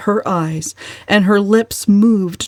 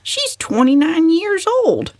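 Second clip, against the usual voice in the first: agitated; 11 semitones higher than usual. A narrator's reading. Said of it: "Cousin Stickles was much agitated".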